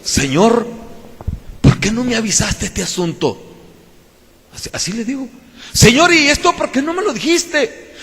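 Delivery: mock grumble at God, pitched well up, half laughing; a playful sulk, scolding the Lord a little; a touch of pouting reproach to God; half-annoyed complaint. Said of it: "así como medio enojadito".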